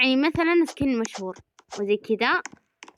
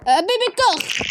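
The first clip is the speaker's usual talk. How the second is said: much higher, louder put-on squeal; excited squeal; 11 semitones higher, 5 dB louder than usual. Gamer picking a favourite skin, excited high voice. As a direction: gush the name out high. Naming new character excitedly.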